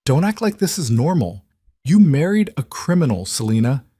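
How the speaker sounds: high, cracking with hurt. Acted. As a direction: plead through a breaking voice. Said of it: "Maya's voice cracked".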